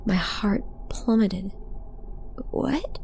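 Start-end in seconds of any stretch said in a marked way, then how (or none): 2.5-3.0 high, soft startled question; shocked. A narrator's reading. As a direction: gasp it out small and high. Heart plummets at shocking news.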